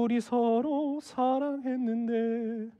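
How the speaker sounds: soft, drawn-out weepy parting; acted weeping, quiet and slow; mock tearful farewell. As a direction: play a slow, hammed-up sob to mother-in-law. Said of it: "울면서 엄마한테 얘기하려고".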